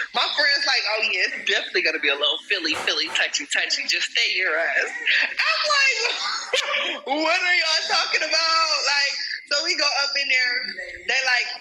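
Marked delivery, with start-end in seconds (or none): 0.9-4.9 quick, lower teasing friend; low fast friend voice. Retold or imitated. Quoting her friend warning it will be a little touchy. 5.3-8.7 high laughing outcry. Retold or imitated. Asking friends what they mean, shrieking with laughter.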